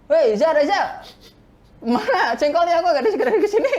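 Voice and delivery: loud, high, bossy call across the room; mock yell. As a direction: bark it like an impatient boss demanding food. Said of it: "nggak pernah teriakin ya". (teriakan pura-pura yang keras dan tinggi)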